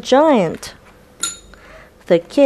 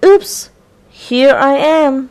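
giant voice; 6 semitones higher, 5 dB louder than usual. A narrator's reading. Giant finally answers.

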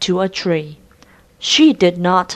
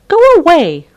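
much higher, louder shout; shooing the dog; 11 semitones higher, 8 dB louder, faster than usual. Acted. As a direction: chase the dog off. Kate shoos the dog.

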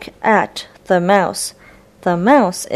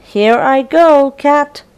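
higher, louder mouse, sailing off; 6 semitones higher, 5 dB louder than usual. Acted. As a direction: call it out with glee. Mouse character voiced in story.

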